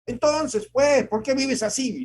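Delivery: shouting father. Retold or imitated. A father yelling at his son about his example, furious. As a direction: scold the son at full voice. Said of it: "Y nos gritaban".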